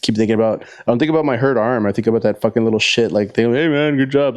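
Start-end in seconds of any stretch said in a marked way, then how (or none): 3.5-4.4 higher, mock-friendly kid voice; imitating the kid. Remembering the kid's taunt, higher mock-friendly voice. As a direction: lightly play the smug teenager.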